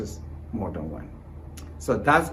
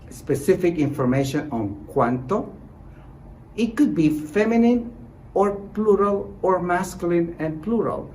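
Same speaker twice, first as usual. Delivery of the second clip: ordinary talk, nothing marked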